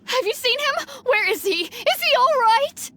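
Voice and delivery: high, urgent worried questions; pressing for news of her child; anxious. Acted. Parent begging the rescuer for news, anxious.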